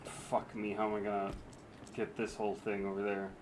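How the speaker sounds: quiet frustrated mutter. Real, not acted. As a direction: grumble it under breath.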